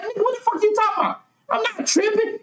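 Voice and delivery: high, fast, incredulous comeback; acted-out exasperated denial; indignant retort. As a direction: fire back in a high, hurried protest. Acted retort to being accused.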